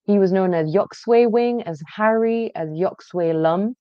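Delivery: ordinary talk, nothing marked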